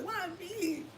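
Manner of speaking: hammed-up cry to the heavens; re-enacting the anguished why; mock wail. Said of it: "I'm just like".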